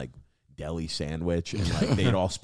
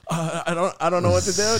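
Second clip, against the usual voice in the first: high, loud flustered plea; mock helplessness; 11 semitones higher, 7 dB louder than usual. Worker looking up at giggling crew, high mock plea. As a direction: plead helplessly in a raised voice.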